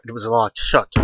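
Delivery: ordinary delivery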